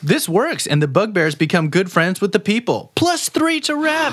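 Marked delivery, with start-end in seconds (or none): 2.9-4.1 high, slow, triumphant call; excited announcement. Acted. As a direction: announce the reward with glee.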